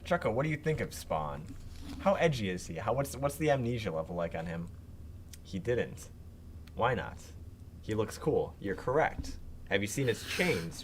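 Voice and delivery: normal talking voice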